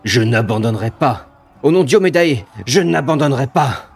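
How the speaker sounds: defiant cry. Acted. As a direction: shout it out, standing firm.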